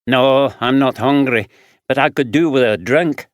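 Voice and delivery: lightly voiced rough Glaswegian man; gruff Glaswegian drifter answering; Glaswegian accent. Fraser voiced.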